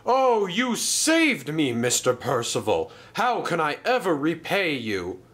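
high, gushing thanks; overwhelmed gratitude. A narrator's reading. Rescued engine gushes thanks.

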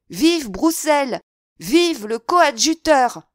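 high, loud, drawn-out acclamation; crowd cheer. A narrator's reading. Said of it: "Puis les cris".

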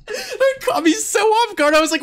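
very high, very loud laughing shrieks; ecstatic screaming. Real, not acted. Streamer beating the level, shrieking with laughing disbelief.

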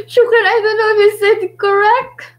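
high, loud, giggly delivery; laughing. Real, not acted. Laughs at own attempt at Arabic.

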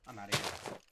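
ordinary talk, nothing marked

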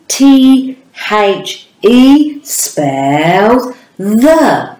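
slow, spelled letter by letter; slow spelling. Shown as a demonstration. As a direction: spell each letter out slowly. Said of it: "Let's remember the word, the letters in it".

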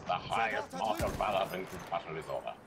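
put-on pompous German officer accent. Officer awarding his soldier the Iron Cross, in a German accent. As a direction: commend them like a proud German general.

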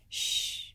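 soft, voiceless shushing; teacher's soft shush; hushing shh. A narrator's reading. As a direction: hush a class with a quiet shh. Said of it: "be as quiet as mice".

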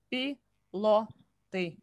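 Пилоты drawn out very slowly; slow and careful. Shown as a demonstration. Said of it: "All together".

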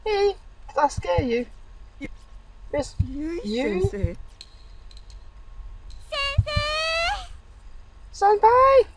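high, playful schoolgirl calling teach; girlish voice. Acted. Schoolgirl lines voiced while reading.

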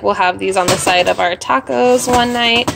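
ordinary talk, nothing marked

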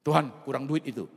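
loud, high demand to God; bossy mock shout; shouted, distant prayer. Shown as a demonstration. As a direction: yell at God like ordering a servant. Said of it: "Nggak usah teriak jauh-jauh".

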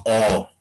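"o" launched by an ejective k pop, drawn out; glottalized k'o. Shown as a demonstration. Teacher shows the glottalized sound, "o" after a popped k. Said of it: "nuestra variante es glotalizada".

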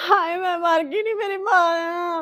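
crying out at the news of her mother's death; broken wail of mourning; wailing grief. Acted.

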